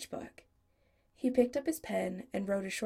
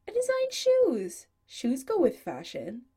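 much higher and louder, offended; shrill-free high indignant protest, louder; indignant outburst; 6 semitones higher, 7 dB louder than usual. Acted. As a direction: squeal it in wounded pride. Chris defends himself indignantly.

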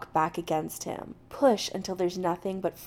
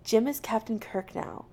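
plain reading voice

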